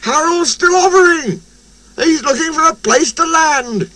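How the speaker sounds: very high, thrilled calling; excited shout. A narrator's reading. Fireman spots Harold still airborne, high excited shout. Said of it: "he shouted".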